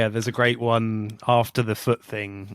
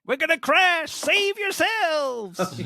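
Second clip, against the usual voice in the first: much higher, very slow hammed-up panic; hammed-up disaster cry, pitched way up; mock panic; much slower than usual. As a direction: wail it like a doomed passenger. Mock panicked movie line.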